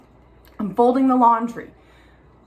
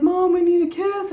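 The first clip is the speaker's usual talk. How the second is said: put-on higher little boy asking sweetly; imitating her son, higher kid voice; Levon's voice; 9 semitones higher, 3 dB louder than usual. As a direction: plead it like a small child. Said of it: "Levon's like".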